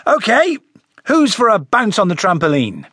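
high, cheery invitation. Acted. Harry rallying everyone to the trampoline, forced bright call. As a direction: call it out with a big smile. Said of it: "He raised his voice, put on a big smile".

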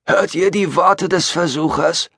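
high-pitched preacher's proclamation; Gabriel. Acted. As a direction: declaim it to a crowd of followers. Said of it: "erklang Gabriels Stimme aus dem Holo". (hoch deklamierender Prediger)

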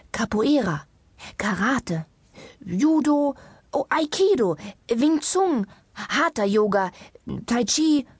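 high, punchy calls; called-out list. A narrator's reading. Doctor calling out martial arts to her patient, insistent. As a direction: call out each item as a fresh idea. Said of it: "rief indessen die Ärztin im Behandlungszimmer".

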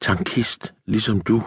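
hushed woman's reply; quiet insistence. A narrator's reading. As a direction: add it softly, holding firm. Woman quietly repeats her refusal.

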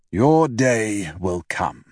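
put-on slow, solemn old man's prophecy; prophetic old father. A narrator's reading. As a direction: foretell it like a wise old father. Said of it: "my father prophesied".

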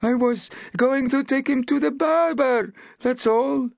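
high, loud, pleading and close to tears; distressed villager. A narrator's reading. Villager pleading his case, high and tearful. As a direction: cry it out, desperate to keep his donkey. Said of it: "cried the villager, almost weeping".

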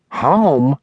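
high, puzzled one-word echo; woman's high incredulous query; surprised assistant. A narrator's reading. Assistant echoes boss in surprise.

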